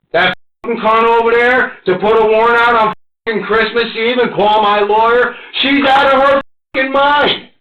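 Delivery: venting real fury at his wife; angry rant. Real, not acted. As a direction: voice climbing into an outraged cry. Furious rant about his wife.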